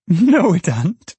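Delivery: high, loud, slow gleeful denial; burst of delight. A narrator's reading. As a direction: crow it with delight. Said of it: "in a burst of delight".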